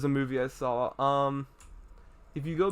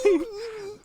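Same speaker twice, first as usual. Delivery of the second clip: high squeal of a laugh; burst of laughter. Real, not acted. Laughing while telling a friend. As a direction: crack up laughing.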